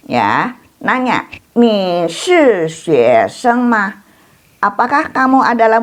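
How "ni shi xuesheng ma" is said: very slow, syllable by syllable; slow model reading. Shown as a demonstration. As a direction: stretch each syllable so students can copy. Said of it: "teacher models Chinese question".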